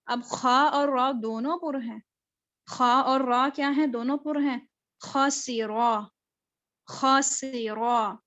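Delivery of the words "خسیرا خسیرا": very slow خسیرا with a thin سین and heavy خا; slow خسیرا, light سین; thin seen. Shown as a demonstration. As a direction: draw out خسیرا, sin kept light. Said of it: "سین کو باریک رکھنا ہے".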